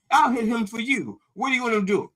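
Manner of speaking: high, sly offer to help; eager instigator. Retold or imitated. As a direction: egg them on, sweet and high. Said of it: "He goes".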